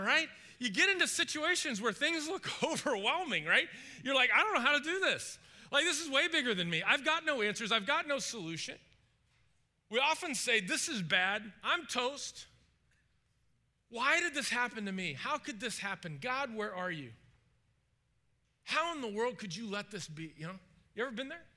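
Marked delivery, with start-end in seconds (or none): 4.3-8.8 high, loud overwhelmed protest; freaking out. Acted. Anyone facing an overwhelming situation, freaking out. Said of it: "we freak out". 10.6-20.3 mild acted despair; freaking out. Believer asking God why this happened, mildly freaking out. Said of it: "He's freaking out".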